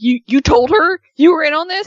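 high, loud, stammered disbelief; imitating incredulity. Miz confronts Maurice over the prank, imitated incredulity. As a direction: act out his indignant realization, voice jumping up. Retold prankster learns he was tricked.

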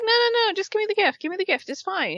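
high squeaky voice of an impatient wife; mock pushy wife. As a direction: squeak it out, brushing him off. Wife pushing for the gift.

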